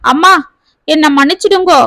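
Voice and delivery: usual speaking voice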